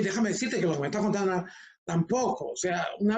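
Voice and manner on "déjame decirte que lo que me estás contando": quick run-together delivery; hurried demo of over-fast talking; too fast. Shown as a demonstration. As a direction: rush the whole sentence in one breath. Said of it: "ese es el otro extremo".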